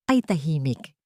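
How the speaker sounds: ordinary delivery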